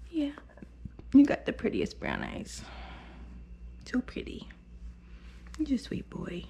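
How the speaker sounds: tender whisper; soft whispered praise. Real, not acted. Groomer cooing over the dog's brown eyes, whispered. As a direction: coo softly to calm him. Soothing dog softly.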